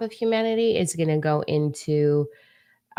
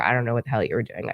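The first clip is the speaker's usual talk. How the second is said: ordinary delivery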